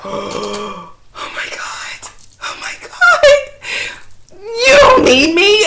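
whispered gasp breaking into a high, drawn-out wail; hammed-up tearful mom, slow and squeaky; mock crying. Mom acts out her tearful reaction.

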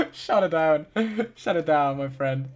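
laughing through the words; laughing mock scolding. Real, not acted. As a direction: laugh while telling him off. Laughing at chat spoiler.

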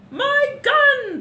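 very high, loud bellow; blustering duke's shout; furious shouting. A narrator's reading. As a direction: roar for it in outrage. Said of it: "the Duke was shouting".

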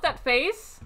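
normal talking voice

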